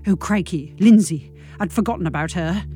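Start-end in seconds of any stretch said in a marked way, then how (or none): none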